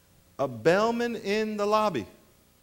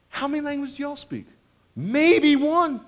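mock quiz of the crowd; 7 semitones higher than usual. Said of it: "mocking his own audience".

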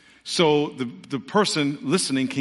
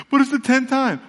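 whiny protest; 10 semitones higher, 5 dB louder than usual. Acted. Preacher voices a complaining believer.

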